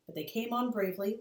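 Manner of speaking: usual speaking voice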